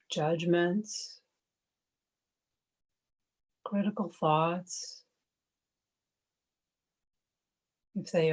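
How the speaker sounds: calm, even meditation voice with long pauses; guided meditation. Acted. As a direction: guide listeners gently into stillness, one unhurried phrase at a time.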